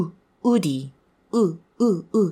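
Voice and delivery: plain vowel ウ with no w glide, as in Japanese ウディ; short Japanese ウ sound; Japanese-style u. Shown as a demonstration. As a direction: say ウディ the Japanese way, starting on a bare ウ. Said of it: "日本語っぽく言うと".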